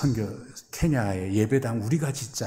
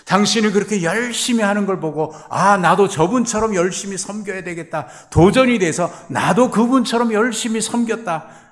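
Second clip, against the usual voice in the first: much higher, louder eager testimony; excited gratitude; 10 semitones higher, 10 dB louder than usual. Acted. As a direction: gush thanks to the one who inspired you. Acted grateful fellow believer. (높고 크게 들뜬 감사의 고백)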